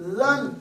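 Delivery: "زن" with a light, fine consonant; light tajweed articulation of "زن"; thin light letter. Shown as a demonstration. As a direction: keep the first sound of "زن" thin. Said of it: "یہیں سے باریک".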